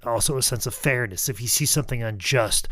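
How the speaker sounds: ordinary talk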